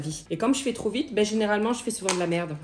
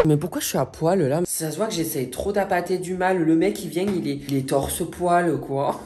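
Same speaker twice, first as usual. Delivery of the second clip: usual speaking voice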